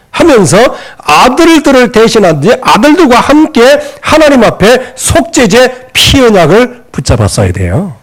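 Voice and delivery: ordinary talk, nothing marked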